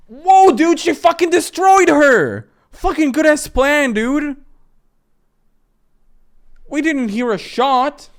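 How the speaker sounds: high, loud disbelief; stunned outburst at the sudden kill; shocked yell. Real, not acted. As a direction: shout at the screen in shock.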